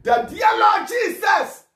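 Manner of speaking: slow, high-pitched shouted invocation; crying out in prayer. Shown as a demonstration. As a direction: cry out to lead the listeners in prayer. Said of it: "begin to cry unto the Lord Jesus".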